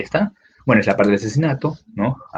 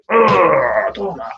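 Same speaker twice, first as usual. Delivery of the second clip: mock scream; 7 semitones higher, much slower than usual. The old woman screams as struck.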